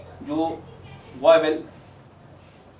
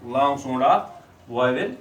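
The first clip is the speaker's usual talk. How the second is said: slow modelling phrase; 3 semitones lower, much slower than usual. Shown as a demonstration. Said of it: "teacher slows to model phrase".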